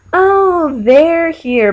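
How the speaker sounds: high, fake-bright oh, they're here; mock unenthused greeting. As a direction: greet someone you merely tolerate. Greeting unwanted party guest.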